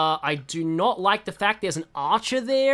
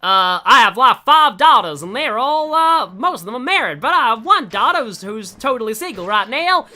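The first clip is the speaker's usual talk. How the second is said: put-on hillbilly southern accent; 4 semitones higher, 9 dB louder than usual. Hillbilly knight offering his daughter to a friend, broad southern accent. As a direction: play a hearty southern dad, loud and twangy. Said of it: "sorry for my horrible southern accent".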